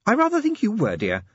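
high, gently amused lady; a patient, teasing wife; genteel lady voice. Acted. Sybil speaks.

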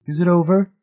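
high, tentative question; lightly acted anxious hope; breathed question. A narrator's reading. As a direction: ask it high, between doubt and relief. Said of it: "Marcia breathed".

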